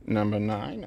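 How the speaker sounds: soft imitation of an eerie record loop; hushed mock-sinister repeat. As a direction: intone it quietly and creepily, rising at the end. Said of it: "In a very sinister voice".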